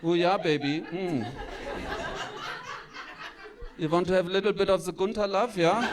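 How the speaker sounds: lightly voiced German lover, hammed-up; mock seduction in a German accent; German dirty talk. [name] woos before sexy time, comically German-accented. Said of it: "the dirty talk".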